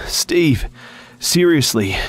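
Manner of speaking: high, upset call; exasperated rebuke. A narrator's reading. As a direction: call him out, fed up. Angry rebuke to absent friend continues.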